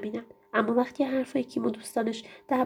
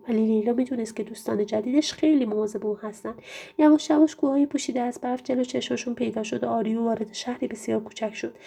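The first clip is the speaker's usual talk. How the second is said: ordinary delivery, nothing marked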